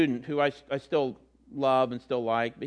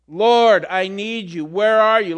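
pleading prayer; 4 semitones higher, 9 dB louder than usual. Acted. Voiced prayer of longing.

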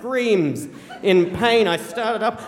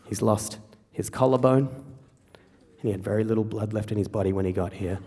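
low, soft, measured narration; subdued storytelling; 8 semitones lower, 6 dB quieter than usual. Acted. Mum's news after the surgery, told in a low hush. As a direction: deliver the news quietly, with pauses.

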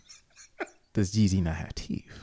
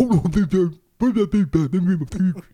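loud toothless rapper halting the show; 8 semitones higher, 7 dB louder than usual. Retold or imitated. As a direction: shout it like a gummy rapper. Acts [name] stopping show.

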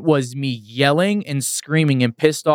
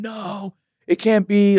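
angry disbelief; 8 semitones higher, much slower than usual. Retold or imitated. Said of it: "I was so pissed off".

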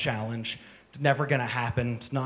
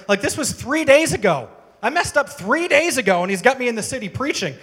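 higher, louder urgent preaching; excited preaching; 8 semitones higher, 8 dB louder than usual. Real, not acted. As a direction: drive it home with rising urgency. Preaching at full force.

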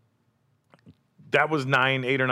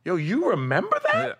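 amazed excitement; 13 semitones higher, 3 dB louder than usual. Retold or imitated. Amazed at Tyson's memory.

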